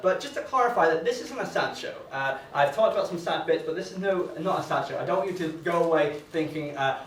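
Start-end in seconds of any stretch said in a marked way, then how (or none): none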